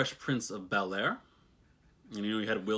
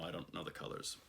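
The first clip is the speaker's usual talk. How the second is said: voiceless whisper, much softer; whispered aside; 13 dB quieter, faster than usual. Real, not acted. To herself about colour names, whispered aside. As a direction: drop to a whisper to yourself. Muttered aside after shrug.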